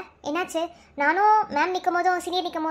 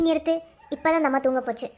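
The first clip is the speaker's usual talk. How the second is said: ordinary talk, nothing marked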